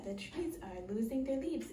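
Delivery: usual speaking voice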